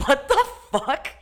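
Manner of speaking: retelling a baffling rude moment; stunned disbelief. Retold or imitated. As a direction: let the disbelief hang on each word. Shocked reaction to rude clerk.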